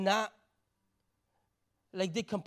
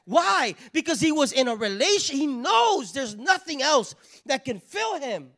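preaching shout; 5 semitones higher, 8 dB louder than usual. Real, not acted. Preacher crying out to the congregation about asking God, impassioned shout. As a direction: belt it out from the pulpit. Preacher at a climax, full force.

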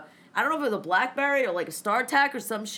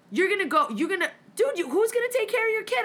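rising higher and higher in alarm; alarmed scolding; 7 semitones higher than usual. Retold or imitated. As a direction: climb up in pitch as the worry grows. Scolding friend about risk.

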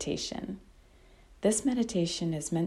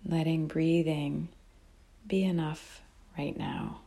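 calm, unhurried meditation guide with long pauses; guided meditation; 5 dB quieter, slower than usual. Real, not acted. Teacher guiding listeners to notice breath and body, calm meditation voice. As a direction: guide them gently, phrase by phrase, leaving long silences.

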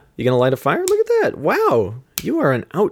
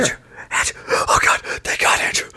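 relieved whisper. Acted.

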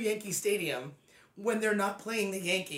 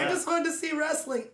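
put-on whining child; 7 semitones higher than usual. Podcaster voicing a child caught in flying bottles, mock whining. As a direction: wail like a child, hammed-up.

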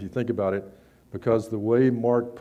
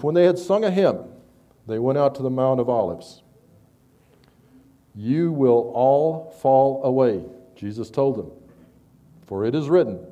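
raised, measured Bible reading voice; scripture reading; 3 semitones higher, 4 dB louder than usual. Acted. Preacher reading Mark fourteen to the congregation, raised solemn reading. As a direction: read the passage out to the congregation with weight.

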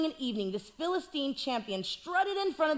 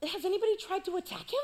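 put-on sheepish young David; 5 semitones higher, 5 dB quieter than usual. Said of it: "He's like".

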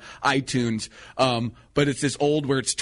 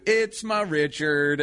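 drawn-out punchline; much slower than usual. Retold or imitated. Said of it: "it'll lead up to".

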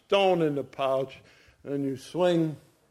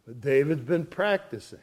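normal talking voice

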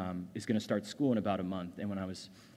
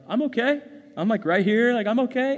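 a much higher, louder, strained reassurance through tears; choked up; 12 semitones higher, 11 dB louder than usual. Retold or imitated. Father to his little daughter at dawn, tearful. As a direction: fight back tears while saying you're fine. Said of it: "why is your face like that".

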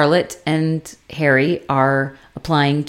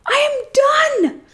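much higher, near-crying shout; losing her mind. Retold or imitated. Carrie explodes at Big in the street, crying shout. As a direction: scream it through tears at him. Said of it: "she fucking loses her mind".

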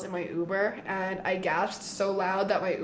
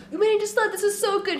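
much higher, bubbling with joy; excited; 11 semitones higher, 3 dB louder, faster than usual. Retold or imitated. To her dad at breakfast, high-pitched delight. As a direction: squeal the news out. Telling dad the good news.

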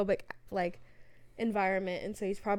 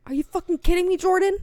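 loud, high Wall Street yell; hammed-up New York; mock New York accent; 10 semitones higher, 8 dB louder, faster than usual. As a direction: shout at Jordan like a broker. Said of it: "guy's name in Wolf of Wall Street".

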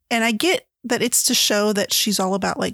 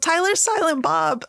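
high, laughing mock yell; 7 semitones higher than usual. Reader re-enacting an outburst at the book, mock yelling. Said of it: "I legit yelled".

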